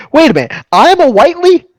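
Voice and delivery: high, loud, fast incredulous cry; mock shock. Player feigns shock at learning his family name, incredulous. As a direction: squeal it out in disbelief.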